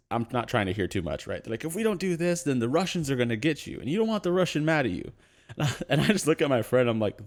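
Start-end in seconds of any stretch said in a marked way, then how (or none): 1.6-5.1 street guy voice. Retold or imitated. Homeless man warns about Russians.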